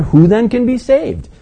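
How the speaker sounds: high, slow, astonished "who then can be saved"; amazed question. Acted. Disciples asking each other, amazed. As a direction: ask it stunned.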